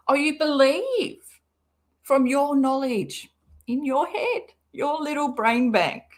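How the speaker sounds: mocking tone in an altered voice; sarcastic mock. Real, not acted. Mocking the post writer's belief, sarcastic. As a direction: belittle her with a teasing sneer.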